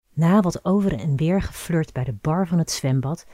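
usual speaking voice

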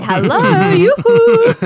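very high, loud yoo-hoo; high sing-song call. Pretend. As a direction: call out like a granny.

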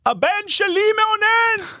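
high, drawn-out mock yell; Menashe shouting. Father announcing it out of the car window, high mock shout. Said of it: "ואז מנשה צועק מחוץ לחלון".

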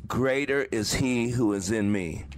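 slow, deliberate recitation, each phrase set apart; a measured, solemn creed said aloud; reciting mantra. Shown as a demonstration. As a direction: speak the mantra slowly to steady yourself. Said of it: "I espoused my mantra out loud".

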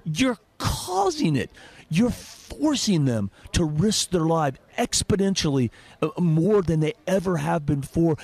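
indignant, high-pitched drawn-out accusation; real anger. Real, not acted. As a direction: throw the blame back, stretching the key words. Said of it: "you know what gets me mad".

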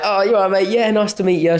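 put-on high, overeager drunk lad; drunk fan. Drunk lad at Winter Wonderland greets the fighter, high and gushing. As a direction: ham up a tipsy, overfamiliar fan.

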